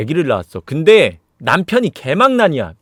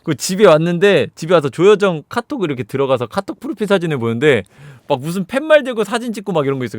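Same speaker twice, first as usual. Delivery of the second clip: usual speaking voice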